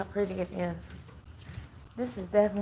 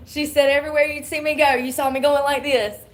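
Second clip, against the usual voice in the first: laughing; 6 semitones higher, 9 dB louder than usual. Real, not acted. Laughing retelling of mama.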